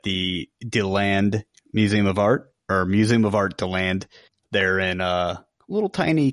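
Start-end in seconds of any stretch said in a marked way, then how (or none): none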